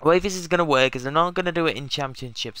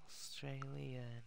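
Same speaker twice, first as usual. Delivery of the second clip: whisper; 23 dB quieter, slower than usual. Real, not acted. Muttering while typing.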